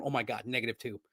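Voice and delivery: low, hushed mock muttering; imitating the head coach. Streamer mimics the coach groaning over bad plays, low and hushed. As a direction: mutter each play like a dismayed coach, low and quiet. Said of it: "He's clicking, going".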